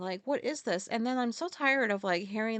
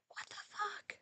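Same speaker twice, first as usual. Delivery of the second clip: breathy whispered disbelief; muttering to herself in shock, whispered; whispered aside; 11 semitones higher, 14 dB quieter, slower than usual. Real, not acted.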